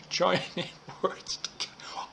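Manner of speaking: real laughter breaking through the words; laughing. Real, not acted. Teacher losing it over students' pronunciation, laughing. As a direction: try to teach on while giggling, voice dropping away. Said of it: "I'm not sure why I'm laughing".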